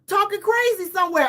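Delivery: normal talking voice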